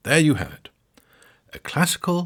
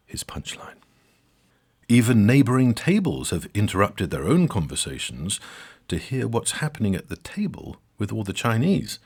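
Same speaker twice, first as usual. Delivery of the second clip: usual speaking voice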